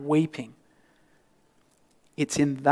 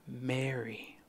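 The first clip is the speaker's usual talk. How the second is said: a whispered name, much softer; tender whisper; 2 semitones lower, 14 dB quieter than usual. Acted. Risen Jesus whispers Mary's name to her.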